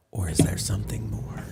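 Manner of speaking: hushed whisper hinting at the painter's dark secret. Acted.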